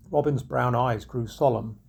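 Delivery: usual speaking voice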